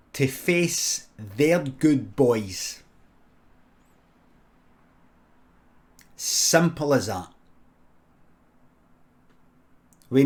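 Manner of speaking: very slow, weighted phrases with long pauses; slow, emphatic explaining. Acted. Recruiter explaining war to a simple recruit, slow and emphatic. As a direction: spell it out slowly, piece by piece.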